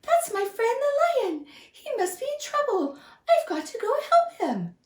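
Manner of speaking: high, squeaky and worried; urgent mouse. Acted. Mouse decides to save the lion, high and urgent. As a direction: rush to help a friend. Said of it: "squeaked the mouse".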